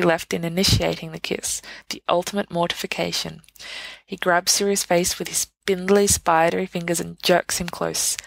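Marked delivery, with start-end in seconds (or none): none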